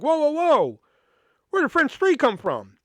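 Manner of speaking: retold shock, pitched high and loud; catching a mistake, voice leaping up; alarmed surprise. Student catches counselor adding French, shocked and loud. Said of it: "I was like".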